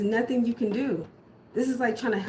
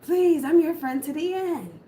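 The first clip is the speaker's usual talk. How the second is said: high, loud, slow hammed-up plea; desperate mock Chucky voice; Chucky begging; 8 semitones higher, 5 dB louder, slower than usual. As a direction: play the doll pleading from the furnace. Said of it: "And he was like".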